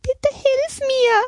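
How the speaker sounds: very high, slow, pleading fawn voice; begging little deer. A narrator's reading. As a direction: beg slowly as a tiny deer. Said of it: "bettelte ein kleines Reh". (flehende, hohe Rehstimme)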